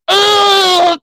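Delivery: loud shrill yelp, hammed-up; high cartoon scream. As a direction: shriek up high like you're hit. Goku hit by spirit bomb.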